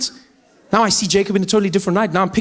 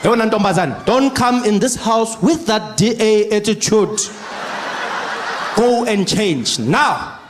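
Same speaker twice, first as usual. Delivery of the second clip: stern slow dad. Retold or imitated. Father orders daughter.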